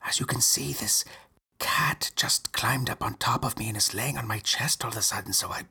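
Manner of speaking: hushed whisper, barely breathing. Real, not acted. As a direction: whisper so the cat stays calm. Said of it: "I can't speak".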